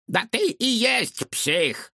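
put-on high, slow cranky old lady shout; grumpy old woman. A narrator's reading. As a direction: scold like a crabby neighbor. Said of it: "раздался крик старушки-соседки".

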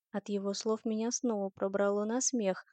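usual speaking voice

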